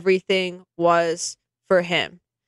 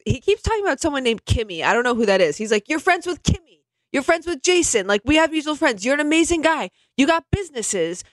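imitating Howie Mandel; 5 semitones higher than usual. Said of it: "Howie is like".

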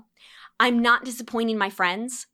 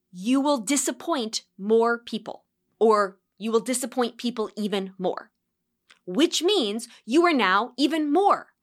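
ordinary talk, nothing marked

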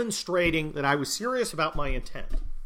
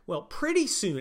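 normal talking voice

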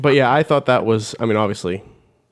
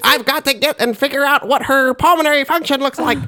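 much higher, laughing put-on nerd voice; science man voice; 12 semitones higher than usual. Mocking the scientist characters, laughing put-on voice. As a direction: do a goofy movie scientist. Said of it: "science man voice".

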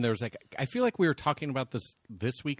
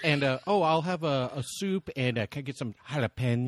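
put-on higher-pitched parody, Spanish food words over-accented; mock Spanish accent switch; 4 semitones higher, 3 dB louder than usual. Mocking overly correct diners ordering, exaggerated Spanish accent on dish names. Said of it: "they just go into a whole nother voice".

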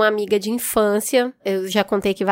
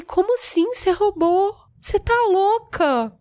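high, stunned disbelief at a friend; hurt, incredulous scolding; mild mock outrage, pitched up; shocked outrage; 8 semitones higher than usual. Confronting friend who stole.

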